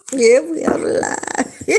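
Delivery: high, drawn-out laughing words. Real, not acted. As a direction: crack up while talking, pitch jumping up. Laughing at own risky joke.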